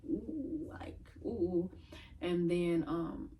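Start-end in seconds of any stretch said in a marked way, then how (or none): 0.1-1.7 teasing ooh. Retold or imitated. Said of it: "her friend just, like".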